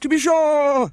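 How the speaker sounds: very high, loud and gleeful; gaily. A narrator's reading. Humpty Dumpty crowing to Alice, high and gleeful. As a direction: crow it out in triumph. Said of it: "Humpty Dumpty said gaily".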